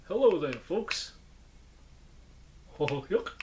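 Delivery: lightly voiced goofy puppet. Greeting folks as the puppet, lightly goofy voice. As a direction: puppet greeting that slips toward cartoon goofiness. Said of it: "make me sound like Goofy".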